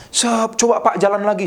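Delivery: high-pitched healing preacher's command; Benihin. Retold or imitated. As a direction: imitate a faith healer ordering someone to walk. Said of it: "Saya tiru-tiru Benihin gitu".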